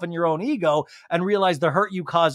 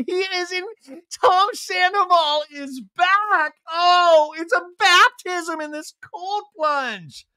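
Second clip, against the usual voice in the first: high, drawn-out rapturous wailing; hammed-up falsetto ecstasy, slow and stretched; vocalized awe; 12 semitones higher, 3 dB louder, slower than usual. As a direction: proclaim it like a mock revival miracle. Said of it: "I'm vocalizing because her".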